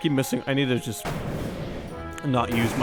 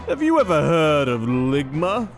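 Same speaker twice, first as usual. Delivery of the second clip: Liquid Snake voice; 5 dB louder than usual. Acted. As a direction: set up the joke like a villain. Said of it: "I already kinda did give him a liquid voice".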